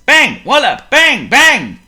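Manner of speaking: loud high banging; drum bangs. Acted. Miss Noisy drumming at breakfast, loud bangs. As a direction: pound each word like a drum.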